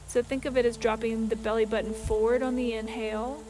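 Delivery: soft, unhurried meditation voice with long pauses; guided meditation. Acted. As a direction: guide them gently through each breath. Leads a breathing meditation.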